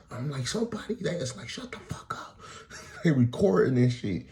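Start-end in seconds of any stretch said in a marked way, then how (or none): 1.5-2.4 hushed mock whisper. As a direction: whisper it under breath. Mocked whisper of bystander.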